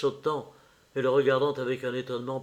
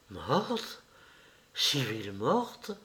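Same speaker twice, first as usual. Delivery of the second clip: softer, slow, hollow shock; barely able to say it; stunned disbelief; 5 dB quieter, much slower than usual. A narrator's reading. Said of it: "Un cri de douleur".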